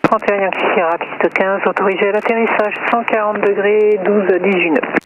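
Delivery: tinny radio voice through headset; crackly aviation radio transmission; over a pilot headset. Real, not acted. Tower radio clearance to aircraft.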